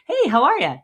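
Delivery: bright, loud, high friendly hello; friendly greeting. Shown as a demonstration. Shop owner greets a customer at the door, cheerful and loud. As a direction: greet them like an old friend walking in. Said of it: "acknowledge them real quick".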